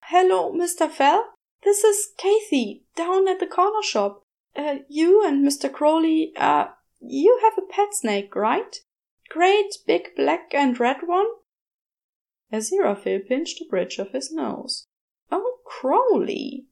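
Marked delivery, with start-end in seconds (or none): 0.1-11.3 put-on higher, brighter-pitched young woman, polite and hesitant; a flustered shopkeeper calling a customer; young shop woman. A narrator's reading. Shop girl Kathy phones Aziraphale about his snake, hesitant. 15.3-16.7 higher, slow, fondly exasperated; exasperated sigh. A narrator's reading. Aziraphale despairs over Crowley's latest trouble, slow and exasperated. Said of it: "he thought".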